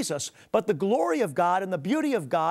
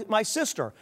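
usual speaking voice

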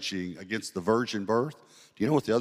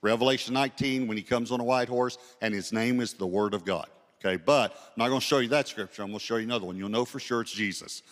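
ordinary delivery

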